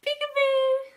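high, loud surprise squeal; playful peekaboo. Pretend. Peekaboo game with toddlers.

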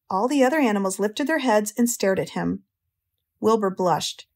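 plain reading voice

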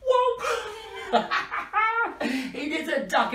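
squealing with delight; excited laughing. Real, not acted. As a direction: laugh through the discovery. Finds duck in bag.

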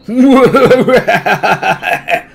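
loud, high, helpless laughter; hysterical laugh. Acted. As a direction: laugh till you can't stop. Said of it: "laughing hysterically".

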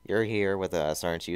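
usual speaking voice

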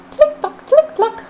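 very high, crisp click clock; dripping click sounds. Acted. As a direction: pluck each click high like water drops. Imitating a dripping faucet.

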